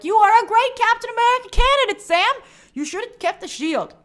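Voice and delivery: high, loud burst of eager praise, easing off at the end; enthusiastic praise. Retold or imitated. Fan gushing at Sam about the shield, high and loud. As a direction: cheer him on like a fan who can't hold it in. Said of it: "And I'm like".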